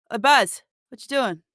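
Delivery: thug calling out. Acted. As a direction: shout over to your buddy, lightly voiced. GM voices thug calling friend.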